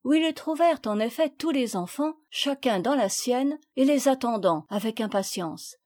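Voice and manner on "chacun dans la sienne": usual speaking voice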